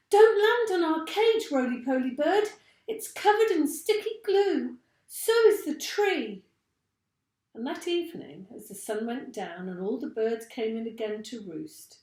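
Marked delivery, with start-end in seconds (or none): 0.1-6.4 high, loud squeaky monkey voices; monkeys shouting a warning. A narrator's reading. Monkeys warning the bird off the sticky cage, high and loud. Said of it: "they shouted out".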